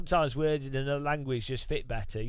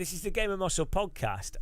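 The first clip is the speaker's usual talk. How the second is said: ordinary talk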